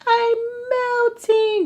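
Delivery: very high, drawn-out mock wail; mock melting. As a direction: melt away theatrically in a high, slow voice. Acts out her inner melting.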